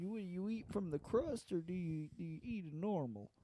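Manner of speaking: softly condescending TV host impression; Doctor Phil. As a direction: ask it delicately, with mock tact. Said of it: "He's all condescending".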